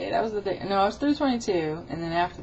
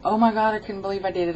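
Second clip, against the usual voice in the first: excited retold disbelief, fast and louder; 3 semitones higher, 7 dB louder, faster than usual. Retelling getting off the treadmill to viewers, excited. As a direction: gush it out quickly in amazement. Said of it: "I was so excited and like".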